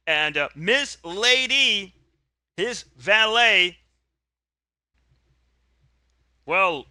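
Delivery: announcer call. Acted. Announcer introduces the champion and his valet, hyped-up call. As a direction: hype the entrance like a wrestling commentator. Ringside announcer calls the entrance.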